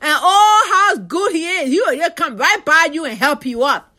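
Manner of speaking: ordinary delivery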